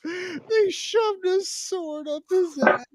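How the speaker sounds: slow, high, stunned; shocked disbelief. Real, not acted. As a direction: drag it out in disbelief.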